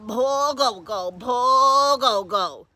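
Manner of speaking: slow, drawn-out lament; pitying. Acted. Deacon leaving in the rain, pitying Coco. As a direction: stretch it out mournfully.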